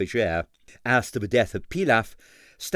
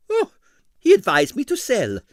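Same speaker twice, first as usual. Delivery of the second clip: put-on indignant, high and loud with a Russian accent; 13 semitones higher, 7 dB louder than usual. A narrator's reading. Impresario to the detective about his broker, indignant. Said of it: "he confided indignantly".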